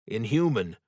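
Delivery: ordinary delivery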